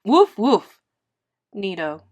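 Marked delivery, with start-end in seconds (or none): 0.0-0.8 loud barking woof; loud dog woofs. Acted. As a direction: bark like a dog. Reader voices dogs barking.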